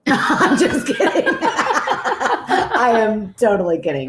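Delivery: high, slow words squeezed through laughter; laughing hard. Real, not acted. Podcaster retracting a joke about the daughter, laughing hard. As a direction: crack up while taking the joke back.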